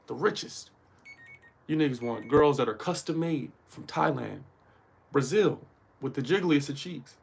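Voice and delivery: usual speaking voice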